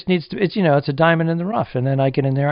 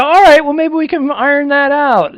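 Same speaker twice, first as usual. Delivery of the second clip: higher, louder, slow mock encouragement; encouraging; 10 semitones higher, 6 dB louder than usual. Co-host imitating himself cheering on a partner, high and slow. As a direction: humour him brightly. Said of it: "I go".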